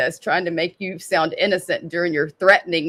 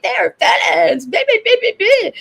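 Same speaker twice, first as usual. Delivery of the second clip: mocking whiny rant; 10 semitones higher, 3 dB louder than usual. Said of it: "And you were like".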